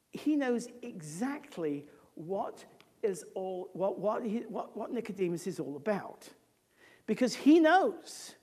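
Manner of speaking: ordinary talk, nothing marked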